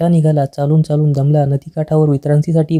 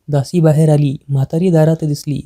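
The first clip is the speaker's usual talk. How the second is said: ordinary delivery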